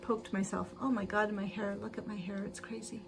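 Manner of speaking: usual speaking voice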